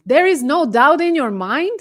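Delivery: loud, swooping incredulous question; incredulous echo. Real, not acted. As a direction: throw the claim back in astonishment. Mocking disbelief at retold answer.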